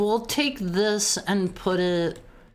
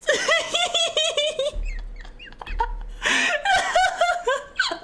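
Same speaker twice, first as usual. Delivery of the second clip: very high, long squealing laugh; shrieking laugh. Real, not acted. Streamer shrieks with laughter at chat's fan fiction. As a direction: let out a long shrieking cackle.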